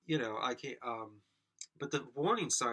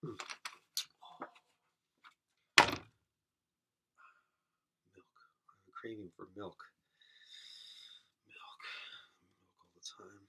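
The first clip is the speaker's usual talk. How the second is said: barely voiced, dropping to a whisper on milk; hushed and fading, half whispered; 4 semitones lower than usual. Real, not acted. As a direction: murmur the craving almost to yourself. Muttering a milk craving to himself.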